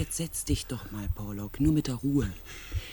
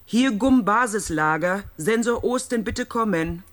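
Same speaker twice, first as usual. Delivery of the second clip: loud, higher call into the radio; 6 semitones higher, 10 dB louder than usual. Acted. Calling over the radio.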